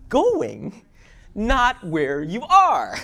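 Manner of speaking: loud, high, slow preacher's cry; preaching at full force. Acted. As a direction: drag it out to the crowd. Preaching climax.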